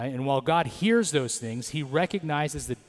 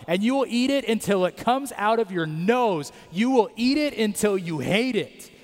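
higher, louder, fed-up parent laying down the law; exasperated parent tone; 6 semitones higher, 3 dB louder than usual. Retold or imitated. God scolding the complaining Israelites, exasperated parent tone. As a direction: scold like a parent who has had enough. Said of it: "I'm sure you recognize the tone that God is giving".

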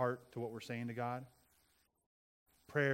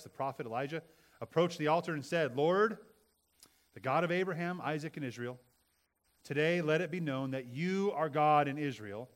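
loud, fervent Bible reading; scripture reading; 2 semitones higher, 7 dB louder than usual. Acted. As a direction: proclaim the passage with fervor. Reads Elijah's prayer.

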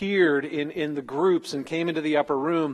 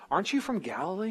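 lightly voiced accuser, higher and quick; a hint of a prying questioner, pitched up; questioning accuser; 4 semitones higher, 5 dB quieter, faster than usual. Slave girl picking up on his accent, mildly accusing.

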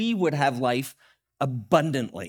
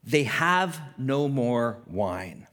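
slow pointed statement; 3 semitones lower, much slower than usual. Retold or imitated. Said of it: "looks at him and says".

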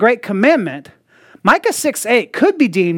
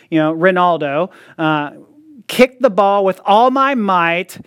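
usual speaking voice

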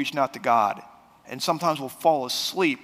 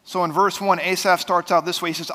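normal talking voice

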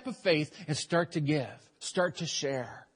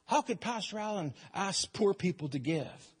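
grumbling congregant; 3 semitones higher, 5 dB quieter than usual. Acted. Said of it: "How often we're like that".

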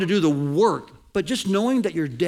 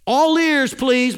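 high, loud call for attention; announcing loudly to get everyone listening; 6 semitones higher, 7 dB louder than usual. Retold or imitated. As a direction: grab the crowd's ears before the command. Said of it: "the emphasis should be at the beginning".

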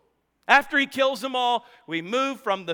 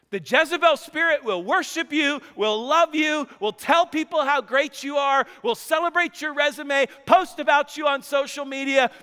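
preaching; 4 semitones higher than usual. Acted. Preaching builds.

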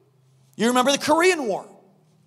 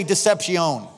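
"deception" with French-style "-see-OWN" ending; put-on French pronunciation of deception; mock French accent; 4 semitones lower, slower than usual. Shown as a demonstration. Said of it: "That's my French accent".

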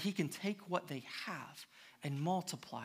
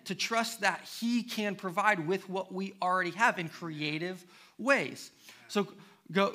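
louder, higher, driving sermon voice; preaching at full force; 4 semitones higher, 6 dB louder than usual. Acted. Preacher urging the congregation on, loud preaching. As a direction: push the lesson home with rising energy.

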